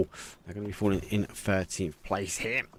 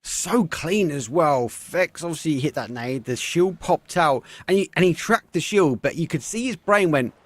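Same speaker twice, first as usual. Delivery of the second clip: esports caster; 8 semitones higher, 5 dB louder than usual. Real, not acted. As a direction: savour the clean play. Live play-by-play.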